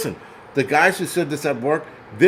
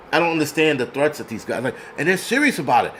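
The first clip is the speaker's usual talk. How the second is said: normal talking voice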